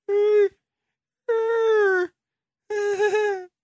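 put-on high, thin whimpering cries of a little girl doll; doll crying. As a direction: sob like a tiny cursed doll. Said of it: "一个女孩的人偶哭了".

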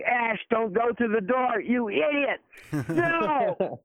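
high-pitched mock yell at a movie screen; acted-out moviegoer's cry, high and urgent; imitated audience yelling. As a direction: heckle the on-screen hero in alarm, pitch way up. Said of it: "where people yell at the screen".

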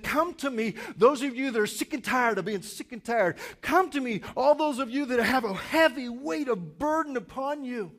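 loud, high, fervent call; preached invitation. Retold or imitated. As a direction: call out to the weary with full force. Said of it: "And Jesus said".

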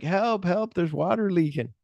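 high, hammed-up call for help; mock cry for help. Acted prisoner calling for help.

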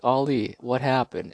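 mock press; eager reporters. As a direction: ask like an excited reporter. Crowd asking Ali.

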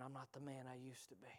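quick, soft, lightly voiced tired man; weary old man. Preacher imitating aging church folk, quiet mock sigh. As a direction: mutter it like a worn-out believer. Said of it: "y'all heard people talk like this".

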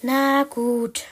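resigned, drawn-out giving in; reluctant, sighed agreement; sighing. Acted. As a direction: give in with a sigh. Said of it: "Penny seufzte".

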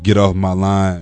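normal talking voice

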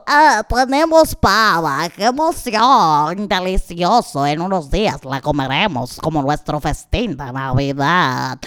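a hint of a weasel licking its lips; greedy weasel. Weasel delights in the turkey. (comadreja glotona saboreando, voz baja)

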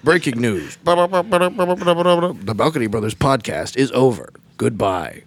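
mock news anchor. Host mock-announcing the podcast is over, news anchor voice. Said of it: "we have to talk like this the whole time".

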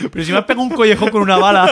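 mock outraged father. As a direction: throw it out in indignation. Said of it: "mock father line".

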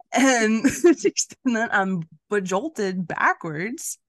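slow, laughing through the words. Real, not acted. Telling her co-host about the ride, laughing slowly. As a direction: drag it out while giggling.

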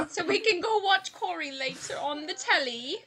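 put-on high, laughing British accent; mock British accent. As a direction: play a cartoon Brit, amused. Joins in the British impersonation.

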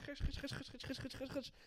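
high sharp khish sounds, over and over; rapid mock slashing noises; imitated sword slashes. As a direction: swish the blades like a kid playing swords.

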